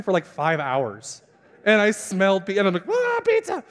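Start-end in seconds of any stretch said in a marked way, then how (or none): 2.9-3.6 high wailing outburst; comic cry of food longing; hammed-up craving. Five-hour faster smells pizza, comically desperate.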